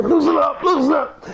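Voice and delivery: very high mock plea; high-pitched, insistent imitation; alarmed protest. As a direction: imitate a trapped man yelping to be let go. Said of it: "I hear".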